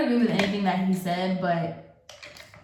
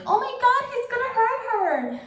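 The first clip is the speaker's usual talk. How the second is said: much higher, squealing mock alarm; mocking panic. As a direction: parody their hysteria in a shrill-high voice. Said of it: "being like".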